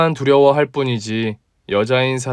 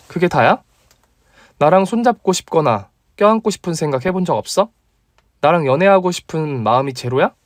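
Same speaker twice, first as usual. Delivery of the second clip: put-on higher, teasing young woman; female friend voice; 5 semitones higher than usual. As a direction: play the bold female doctor, pitched up. (높은 톤의 당찬 여자 목소리)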